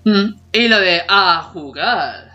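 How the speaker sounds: ordinary talk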